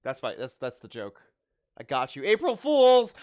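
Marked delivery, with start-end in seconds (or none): 2.2-3.1 loud, high "gotcha" yell; booming mock triumph; gleeful prank shout. Springing a prank on someone.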